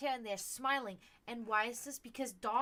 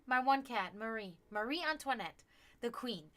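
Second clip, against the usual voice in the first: normal talking voice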